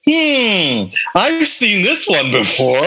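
put-on high, slow Vietnamese accent. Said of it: "somebody with a Vietnamese accent and said".

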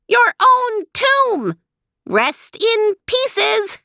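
very high mechanical villain bird, gloating; taunting robot bird; tinny voice. A narrator's reading. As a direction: sneer it in a high robotic monotone, slowing at the end. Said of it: "a tinny voice from the downed Chukar".

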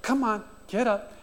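put-on high, gentle coaxing to a fallen toddler; lightly voiced tender parent; soothing parent. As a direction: comfort the crying baby, softly. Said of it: "No, they're like".

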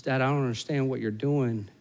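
soft, tentative question to Dad; lightly voiced wary little boy, hushed; cautious child. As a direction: ask it quietly, unsure what is coming. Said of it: "he's still a little cautious".

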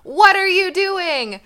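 loud, slow, high outburst; angry Moses. Retold or imitated. Moses yelling at his people over the calf, loud slow outrage. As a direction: let it explode at the idol worshippers.

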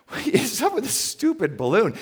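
high-pitched exasperated outburst; annoyed. Retold or imitated. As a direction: complain in acted-out annoyance. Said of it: "I was just so annoyed by it".

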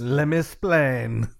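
put-on slow, Cuban-accented Ricky Ricardo line; Ricky Ricardo impression. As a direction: draw it out like Desi Arnaz as Ricky. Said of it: "Way to go, Ricky Ricardo".

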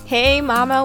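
usual speaking voice

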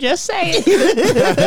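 high, drawn-out wheedle; slow, squeaky coaxing; mock pleading. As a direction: stretch out a comic plea. Defendant begging jury, acted.

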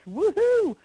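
high, loud owl hoot; owl hoot imitation. Shown as a demonstration. Caller shows the stranger the owl's sound, loud hoot. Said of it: "and I imitated the sound".

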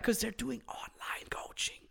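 whispered like a secret. Acted. Podcaster letting listeners in on a secret, whispering. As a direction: lean in and whisper the answer.

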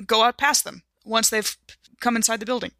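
ordinary delivery

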